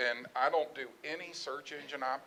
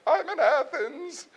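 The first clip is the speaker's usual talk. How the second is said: mock sobbing; 13 semitones higher, 6 dB louder, slower than usual. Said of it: "she was sobbing".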